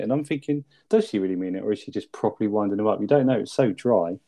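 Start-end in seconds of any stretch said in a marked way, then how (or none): none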